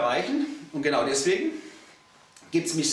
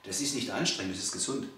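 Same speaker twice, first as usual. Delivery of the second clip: lower, softer "Das ist nicht anstrengend", spoken low; quiet correction; 7 semitones lower, 7 dB quieter than usual. Shown as a demonstration. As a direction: calmly set the client straight, low and soft. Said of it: "Das ist ja alles so anstrengend".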